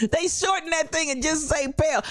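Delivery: words broken up by laughter; laughing. Real, not acted. Host reading a viewer comment, laughing hard. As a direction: laugh through the line.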